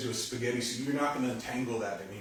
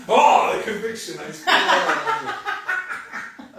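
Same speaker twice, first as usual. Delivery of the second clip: high, loud words through laughter; laughing hard; 12 semitones higher, 10 dB louder than usual. Real, not acted. Teacher joking about spilling water on a listener, laughing hard. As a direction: crack up while talking.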